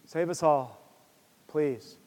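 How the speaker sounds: a quiet, fading, whimpering prayer in a put-on voice; lightly acted king begging God to save them; mock helpless plea. Preacher imitating a passive king, quietly pleading. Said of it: "Asa doesn't just sit back and be like".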